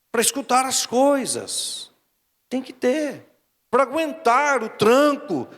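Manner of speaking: slow, rising preacher's insistence; preaching build. Acted. Pastor urging the congregation to endure, slow rising preaching.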